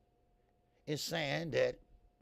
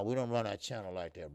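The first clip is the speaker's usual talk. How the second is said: ordinary talk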